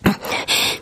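eerie whispered chuckle. Acted. Caller laughs eerily.

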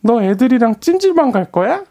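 put-on high questioning girl; girl's voice. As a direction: ask like a surprised girl. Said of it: "여자 목소리를 낼 때".

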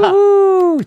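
loud, high whoop; excited. Real, not acted. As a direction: cheer like a fan. Cheer greeting the guest.

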